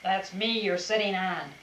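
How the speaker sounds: ordinary delivery, nothing marked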